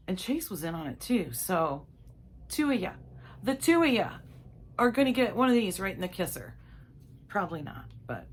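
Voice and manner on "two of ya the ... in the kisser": high, loud playful warning; mock threat. Threatening husband and son with payback, loud mock warning. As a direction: jokingly promise a pie in the face.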